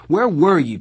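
high, loud demand; angry, rising voice. A narrator's reading. Darry questioning his late brother, voice rising in anger. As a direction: raise your voice in angry questioning. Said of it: "His voice was rising".

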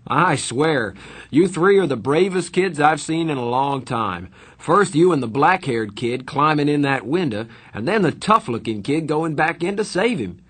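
admiring fireman. A narrator's reading. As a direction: tell them they're heroes. Fireman praises the boys.

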